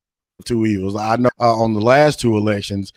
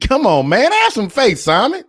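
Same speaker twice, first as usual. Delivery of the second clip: pleading high; 12 semitones higher than usual. Real, not acted. Teasing plea to co-host.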